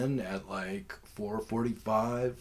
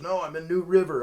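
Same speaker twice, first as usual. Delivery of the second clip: higher, louder carefree guy; imitating the seller; 9 semitones higher, 9 dB louder than usual. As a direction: play the unbothered seller. Seller's reply voiced in story.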